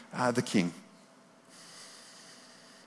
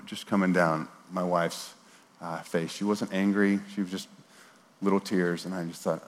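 ordinary talk, nothing marked

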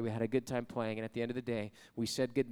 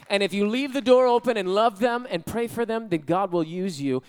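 ordinary talk

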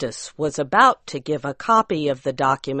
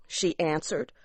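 ordinary delivery

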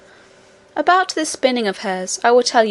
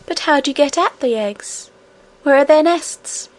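lightly raised little princess voice; a hint of an eager young princess; cartoon princess; 4 semitones higher than usual.

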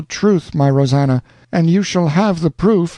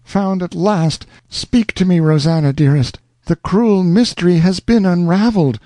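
joyful outburst; 8 semitones higher than usual. A narrator's reading. Alonzo calling down the telephone to Rosanna, overjoyed. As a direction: pour out relief and love. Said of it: "burst forth with the exclamation".

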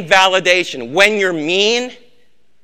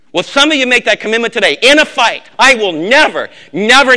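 loud, high, hammered vow; a vow thundered from the pulpit; fiery preaching; 3 semitones higher, 7 dB louder than usual. Acted. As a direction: preach it hard, pounding each never. Preaching vow at full force.

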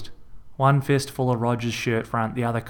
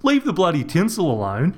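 higher, louder bloke's yell; 7 semitones higher, 3 dB louder than usual. A narrator's reading. Rog yelling as his brother wrecks the tinsel. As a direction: yell at him in disbelief.

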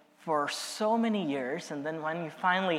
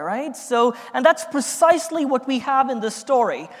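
louder, building sermon voice; fiery preaching; 4 semitones higher, 7 dB louder than usual. Acted. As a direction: preach it with rising force. Preaching the resurrection story.

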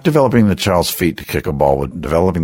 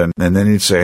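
ordinary delivery

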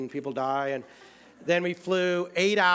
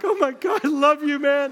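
much higher and louder, bursting with relief; overjoyed relief; 10 semitones higher, 8 dB louder than usual. Retold or imitated. Stranded traveler greeting a friend at the dock, ecstatic relief. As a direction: blurt it out like seeing a savior. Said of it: "Seriously, I was like".

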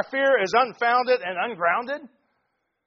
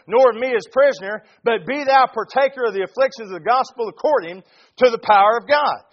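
loud, fervent sermon delivery; preaching at full force; 3 semitones higher, 6 dB louder than usual. Acted. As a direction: preach it hard to the congregation.

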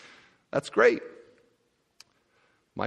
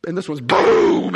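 drawn-out, louder boom for the showiest gift; big imitated boom; 3 semitones higher, 8 dB louder, slower than usual. Preacher mimicking a rich man's gift: loud slow boom. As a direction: make the coin bag land with a heavy boom. Biggest bag dropped with effect.

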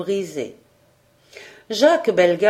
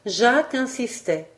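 ordinary delivery

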